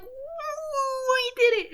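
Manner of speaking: very high, drawn-out cheer; triumphant whoop. Acted. Joker celebrates standing on a truck, triumphant.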